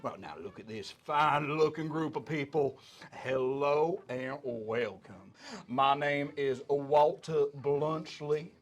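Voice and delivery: loud, slow, grandiose elephant proprietor with Southern drawl; booming folksy showman. Acted. Elephant proprietor welcoming new arrivals, booming folksy drawl. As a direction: greet the crowd grandly, stretching the words. Game master voices elephant folk.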